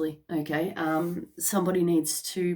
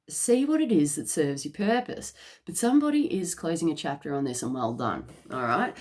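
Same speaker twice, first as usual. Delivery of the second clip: ordinary talk, nothing marked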